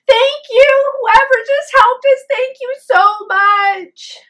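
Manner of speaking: high, loud, slowing on so much; gushing thanks. Retold or imitated. Wife thanking an unseen rescuer in the woods, gushing. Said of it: "And she says".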